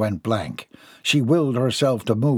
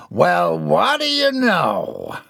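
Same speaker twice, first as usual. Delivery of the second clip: slow, higher amused country drawl; 6 semitones higher, much slower than usual. Acted. Jeb grins at his wife's fight, slow and amused. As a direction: savor the surprise.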